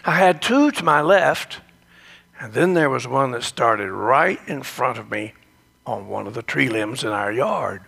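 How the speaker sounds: usual speaking voice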